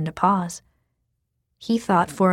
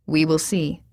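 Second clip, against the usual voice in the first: noncommittal; 3 semitones lower than usual. Acted. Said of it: "their father said noncommittally".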